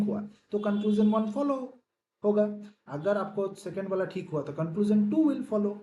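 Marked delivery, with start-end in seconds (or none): none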